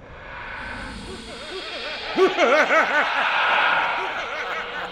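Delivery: high, long villain's cackle; evil laugh. Acted. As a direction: cackle in triumph. Villain gloats over dying mummy.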